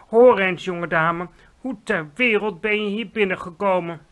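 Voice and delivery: loud, stern maid demanding answers; sharp, loud scolding; stern challenge. Acted. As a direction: confront the little intruder. Maid confronts the girl.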